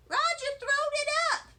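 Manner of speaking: high, loud tattling holler. A narrator's reading. First grader tattling to the janitor, loud high holler. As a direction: yell it out, almost singsong. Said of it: "I hollered out".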